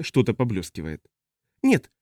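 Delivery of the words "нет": quick, abrupt refusal; blurted out. A narrator's reading. Customs officer refuses to undress, blurting it out. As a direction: blurt it out in a hurry. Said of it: "выпалил таможник".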